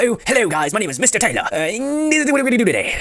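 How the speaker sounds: high-pitched, odd teacher parody; exaggerated high greeting from a weird teacher; Mister Taylor. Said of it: "he kinda talks like X".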